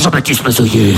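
very low announcer voice; trailer title voice. Pretend. Trailer announcer naming the star, very low. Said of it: "comme si je suis dans un film de flic".

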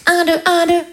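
a hint of a singer's count-in. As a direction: count in the band, lightly. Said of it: "brosse à cheveux".

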